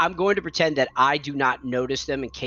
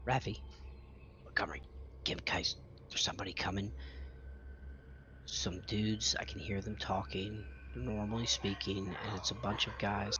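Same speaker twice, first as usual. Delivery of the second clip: soft, urgent warning whisper; 3 semitones lower, 12 dB quieter, faster than usual. Acted. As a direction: wake them quietly, danger close. Waking friends, enemies near.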